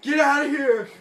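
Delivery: loud, high acted yell; shouted command. Doctor Grant distracting the T-Rex with fire, loud yell. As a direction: holler it as if driving off a monster.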